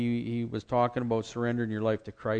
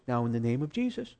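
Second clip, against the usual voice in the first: little lady praying. Retold or imitated. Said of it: "little lady that prays".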